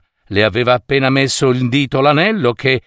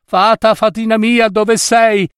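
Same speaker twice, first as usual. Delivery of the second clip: very high-pitched, searching call; 10 semitones higher than usual. A narrator's reading. Young man searches for his fairy, calling out. Youth calls for fairy again.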